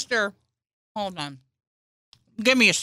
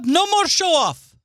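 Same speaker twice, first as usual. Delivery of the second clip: mock scolding; 9 semitones higher, 3 dB louder than usual. Mock angry parent scolding.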